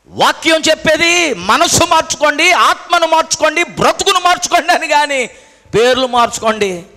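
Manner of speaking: loud, high sermon voice; preaching at full force. Real, not acted. Preacher challenging believers about their names, forceful preaching.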